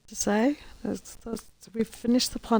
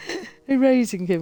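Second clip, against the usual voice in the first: erasing him laughed out, louder; laughing; 5 semitones higher, 7 dB louder than usual. Real, not acted. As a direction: burst into laughter mid-question.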